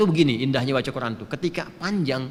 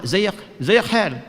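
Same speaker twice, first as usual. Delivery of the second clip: slow, drawn-out Egyptian Arabic greeting; Egyptian dialect; 3 semitones higher, 3 dB louder, much slower than usual. Retold or imitated. Teacher showing students the Egyptian greeting. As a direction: greet like a Cairo local, slowly. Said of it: "Orang Mesir bilang apa?".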